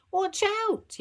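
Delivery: high startled yelp. Acted. Mum as the train brakes hard, yelp. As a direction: yelp a warning. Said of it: "yelped Mum".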